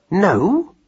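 high, incredulous one-word question; mildly exclaimed challenge; snappy cry. A narrator's reading. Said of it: "cried Madeline".

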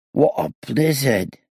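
put-on high, slow, plaintive child's voice; reedy and forlorn, drawn out. A narrator's reading. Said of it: "it said in a plaintive and reedy voice".

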